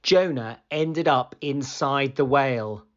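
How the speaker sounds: usual speaking voice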